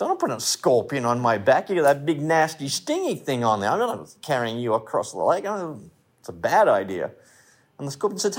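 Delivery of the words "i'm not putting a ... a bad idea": lightly voiced frog, low and quick; low, hurried mild protest, lightly acted; reluctant frog. Frog refusing to carry the scorpion across, mildly acted reluctance. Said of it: "The frog says".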